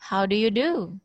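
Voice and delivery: reply "How do you do?" with no questioning lift; even answering tune on "How do you do?"; flat response intonation. Shown as a demonstration. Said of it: "Nah, datar ya".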